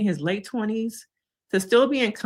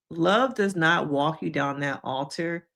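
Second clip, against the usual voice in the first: normal talking voice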